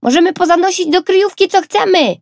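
high, loud, fast call. A narrator's reading. Kajtek calls Tosia out of hiding, excited shout. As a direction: shout the good news across the garden. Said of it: "zawołał".

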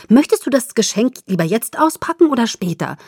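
bubbly, eager friend's voice; eager question. A narrator's reading. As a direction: ask it brightly and eagerly. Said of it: "fragte sie eifrig".

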